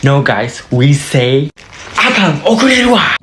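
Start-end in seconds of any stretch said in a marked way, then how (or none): 2.0-3.1 fast, high exclamation; flustered latecomer. Acted. Learner warned they will be late, flustered and hurried. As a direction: blurt it out in a hurry. Japanese take on running late.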